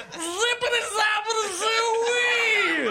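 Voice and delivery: very high, stretched cartoon scream; hammed-up falsetto scream; screaming Bill Cosby. A narrator's reading. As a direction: shriek the nonsense words in comic agony. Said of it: "screamed the Presidential Medal of Freedom recipient".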